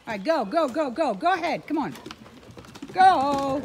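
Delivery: high loud go go go; urging on. Real, not acted. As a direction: cheer the dogs to run. Sending dogs running.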